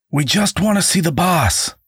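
shouting above the tumult; shouting over noise. Acted. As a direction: call to the bartender through the din. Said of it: "Woodrow shouted to him over the tumult".

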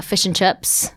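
slow New Zealand accent. Shown as a demonstration. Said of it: "it's a little like New Zealand".

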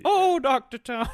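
high, loud, slow attempt at an Irish accent; failed Irish accent. Co-host attempts an Irish accent and fails, high and slow. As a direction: try an Irish accent and fumble it. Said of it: "I can't do an Irish accent".

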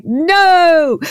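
a loud, high "No!"; defiant shout. Pretend. Kara refusing to yield to the villain: loud, high defiant shout. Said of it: "she's like".

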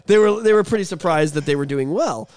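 usual speaking voice

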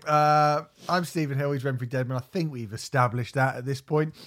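energetic announcer-style podcast intro; podcast host intro. Acted. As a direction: welcome listeners like a radio host. Opens the show over music.

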